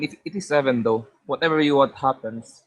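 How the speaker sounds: ordinary talk, nothing marked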